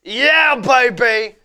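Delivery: put-on high, loud excited "yeah baby"; impression voice. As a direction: belt it out like a wild party guy. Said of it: "I'll do one of these impressions".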